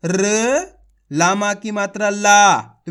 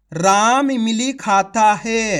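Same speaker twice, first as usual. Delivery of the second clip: slow, loud, every word clear; slow careful reading; 4 dB louder, much slower than usual. Shown as a demonstration. Teacher to pupils, reading the sentence slowly and clearly. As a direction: read the whole sentence slowly for children. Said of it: "कैसे पढ़ेंगे अपन इसको?".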